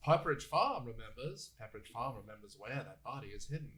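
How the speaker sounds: old-style Maine accent impression; imitated Maine accent, dropping away at the end; Fred Gwynn. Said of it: "Fred Gwynn from, like, the original".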